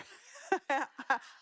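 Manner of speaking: high, happy burst of laughter; delighted laugh. Retold or imitated. Father on the phone to Ted, laughing delightedly. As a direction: laugh with glee at yourself.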